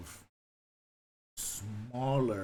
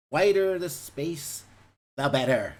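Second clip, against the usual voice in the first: louder, higher and slow, spelling out the rule; emphatic distancing signal; 5 semitones higher, 8 dB louder, slower than usual. Retold or imitated. Miming his six-feet signal to shoppers, loud and slow. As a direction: stretch it out like a firm warning to a stranger. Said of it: "this thing is like".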